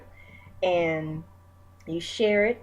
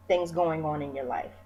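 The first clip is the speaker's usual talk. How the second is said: ordinary talk, nothing marked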